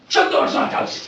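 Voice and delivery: loud, abrupt battle cry; sudden yell. Acted. Batman yelling at the wailing second punk, sudden shout. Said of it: "So I go".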